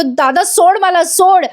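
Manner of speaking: high, slow, drawn-out mock demand; acted protest. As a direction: imitate someone pleading to be let go. Acted struggle over a knife. (नाटकी, लांबवलेल्या सुरात विरोध)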